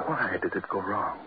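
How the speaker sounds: normal talking voice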